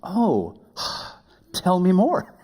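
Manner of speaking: high, slow, sighing; mock delighted sigh. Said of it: "he thought about going".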